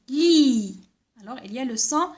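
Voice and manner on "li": louder, long "Li", held lee; sounded-out syllable. Shown as a demonstration. Teacher isolating the first syllable for children, sounded-out syllable. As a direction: stretch "Li" out as one syllable. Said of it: "Regardez, L-I".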